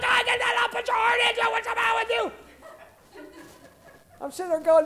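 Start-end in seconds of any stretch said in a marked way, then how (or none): 0.0-2.3 high, shouted gym taunts; mocking shout from the weight bench; gym buddies yelling. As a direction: bellow like a lifting buddy egging him on. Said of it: "they're over there yelling".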